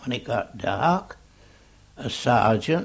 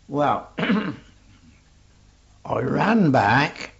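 ordinary talk, nothing marked

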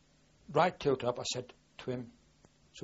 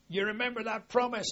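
excited call; 11 semitones higher, 5 dB louder than usual. Retold or imitated. Joyful reunion on a platform.

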